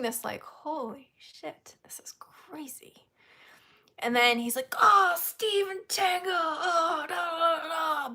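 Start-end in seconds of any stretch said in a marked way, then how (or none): 0.4-3.1 slow, high stunned whisper; retold disbelief, fading to a whisper; hushed mock awe. Awed reaction while watching the hugs. 4.8-8.1 high, drawn-out delighted cry; hammed-up squeal, trailing into babble; imitating excited greeting. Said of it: "he was like a little kid".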